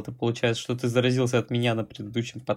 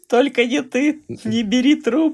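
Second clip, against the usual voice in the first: high squeaky laugh through the words; giggling while talking; 5 dB louder than usual. Real, not acted. Joking quote while laughing.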